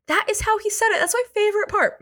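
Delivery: high-pitched, delighted disbelief; gleeful insistence. Real, not acted. Host swears to listeners that's how he said it, gleefully insistent.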